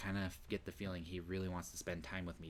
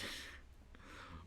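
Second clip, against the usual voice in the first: breathy laugh; 9 dB quieter than usual. Real, not acted. Reacting to game.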